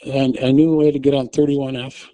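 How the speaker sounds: usual speaking voice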